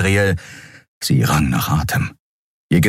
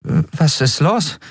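higher, slow puzzled question; hesitant, higher-pitched confusion; confused; 9 semitones higher, slower than usual. Acted. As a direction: ask it slowly, bewildered. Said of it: "fragte Endriel verwirrt".

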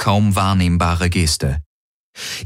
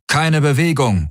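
raised-pitch command, not truly shouted; mock bellow; 7 semitones higher than usual. A narrator's reading. Golvonen threaten everyone in the garden, mild bellow. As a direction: bark an order at restrained volume. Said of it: "Sie brüllten".

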